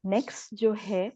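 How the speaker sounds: ordinary talk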